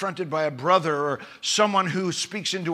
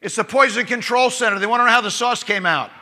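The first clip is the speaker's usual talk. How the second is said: higher, louder call across the room; yelling out; 5 semitones higher, 7 dB louder than usual. Retold or imitated. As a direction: shout it out to the whole dinner party. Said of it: "the lady yells out".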